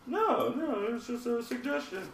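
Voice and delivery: high-pitched mock-innocent voice; playful high-voiced denial. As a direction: plead innocence in a squeaky high voice. Acting out his innocent reply to them.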